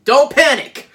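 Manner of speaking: sudden high, loud shout; shouted warning. Real, not acted. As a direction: holler it to panicking listeners. Mock alarm warning to listeners.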